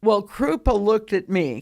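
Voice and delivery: usual speaking voice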